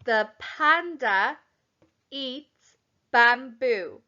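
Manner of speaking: the panda eats bamboo said very slowly; slow dictation. Shown as a demonstration. Teacher repeats dictation sentence slowly. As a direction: spell it out word by word. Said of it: "That was".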